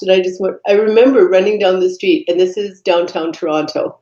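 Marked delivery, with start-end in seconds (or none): none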